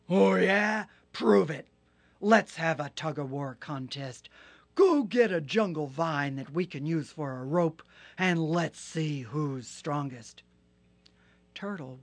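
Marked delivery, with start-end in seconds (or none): 0.1-10.4 loud, gruff hippo dare; scoffing Hippo. Acted. Hippo daring Turtle to a contest, loud and scoffing. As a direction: sneer the challenge, booming.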